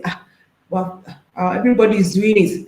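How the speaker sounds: lightly voiced careless excuse; mock shrug. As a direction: brush it off like everyone does it. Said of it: "We hear people that say".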